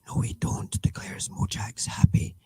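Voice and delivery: whispered, unimpressed retort; a quiet whispered "No, we don't"; dry whisper. Acted. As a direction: hush it like a private aside. Whispered reply to headline.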